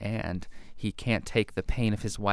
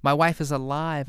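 mildly hammed-up cry, raised in pitch; half-hearted scream from a melodrama; mock scream; 6 semitones higher, 5 dB louder than usual. Author reading his story's distraught husband, mock scream. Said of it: "I screamed at the sofa".

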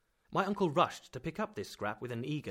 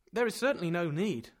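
loud, higher stung reply; wounded pride; 7 semitones higher, 9 dB louder than usual. A narrator's reading. Nephew stung by uncle, wounded pride. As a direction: answer stiffly, pride hurt. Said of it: "in the tone of a man wounded in his pride".

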